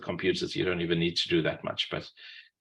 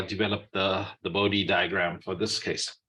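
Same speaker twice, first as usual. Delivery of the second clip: ordinary talk, nothing marked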